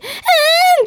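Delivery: high, drawn-out delighted moan; pleased swooning moan.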